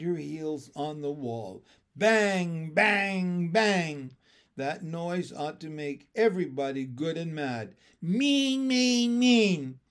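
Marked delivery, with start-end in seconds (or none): 2.0-4.2 loud beats. A narrator's reading. Heels on the wall. 8.0-9.8 very high, loud, beat by beat; rhythmic yell. A narrator's reading. Ramona yells at her family, kicking the wall. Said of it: "she yelled in time to her drumming heels".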